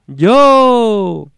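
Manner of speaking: high, drawn-out distant call; echo. Acted. Voice answering from the other side, echoing call. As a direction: stretch it out like an echo, falling at the end. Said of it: "una voz le dijo".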